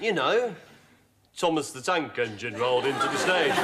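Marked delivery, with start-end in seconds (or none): 1.4-3.6 Welsh accent. Shown as a demonstration. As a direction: lower demo in a Welsh accent.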